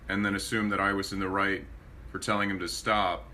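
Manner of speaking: normal talking voice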